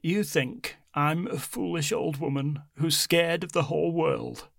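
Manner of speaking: lightly voiced old grandmother; hint of a proud old woman. A narrator's reading. Grandmother character voice.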